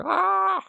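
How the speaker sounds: sharp high mock shriek; imitating villain cry. Viewer copying the Mad King's laugh, high mock shriek. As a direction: screech it like the cackling Mad King. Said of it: "That's what it sounded like".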